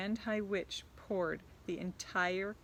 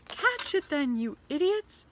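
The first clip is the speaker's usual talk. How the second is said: high, loud furious witch; screaming Grand High Witch; 9 semitones higher, 8 dB louder than usual. Acted. Grand High Witch screams at her witches from the platform. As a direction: scream at your useless minions. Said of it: "screamed the Grand High Witch".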